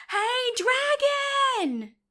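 high, drawn-out yell; shouted at full volume. A narrator's reading. Elizabeth yelling into the dragon's ear, as loud as she could. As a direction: holler it as loud as you can, stretched long. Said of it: "shouted as loud as she could".

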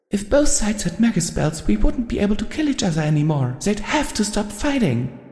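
high, far-off voice of a timid pony; small high confession. A narrator's reading. Timid pony confesses to her friend, high and small. Fluttershy explains, determined.

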